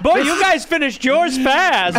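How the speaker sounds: mock cheery diner. Joking about eating beside the lions, slow smug tease.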